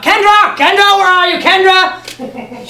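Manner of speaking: high, loud call down the hallway. Retold or imitated. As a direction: call her name through the house. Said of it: "calling out".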